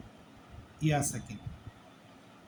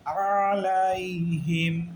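"আলাইহিম" with every vowel stretched very long; slow, drawn-out "আলাইহিম", each syllable held; wrongly dragged out; 2 semitones higher, 4 dB louder, much slower than usual. Shown as a demonstration. Teacher showing what not to do, "আলাইহিম" dragged slowly. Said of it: "এরকম বলা যাবে না".

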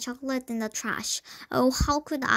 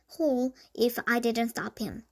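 ordinary delivery

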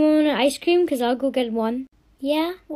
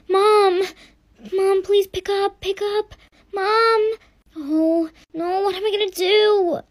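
panicked calling; 6 semitones higher, slower than usual. Acted. Labouring girl calls for mom.

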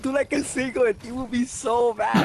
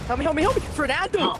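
rapid, higher cries for help; pleading yell; 6 semitones higher, 3 dB louder than usual. Real, not acted. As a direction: beg your teammate to save you. Low health, begging teammate for help.

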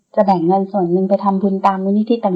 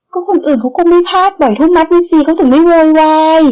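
higher pitched, whining grumble; whiny complaint; 8 semitones higher, 4 dB louder than usual. A narrator's reading. Mattanawee grumbling at the fine rule, high whine. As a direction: moan it like a sulking friend. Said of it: "มัทนาวีโอดครวญ".

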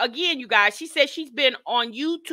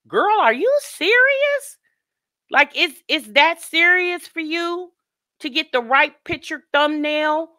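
incredulous scolding; 8 semitones higher, 4 dB louder than usual. Retold or imitated. Vlogger reacting to a mom's thumbnail stunt, incredulous. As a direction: call her out, louder and stretching the words.

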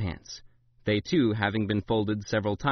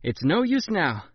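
triumphant; 7 semitones higher than usual. A narrator's reading. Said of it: "grinning triumphantly".

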